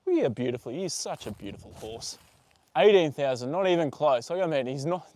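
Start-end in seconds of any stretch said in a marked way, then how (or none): none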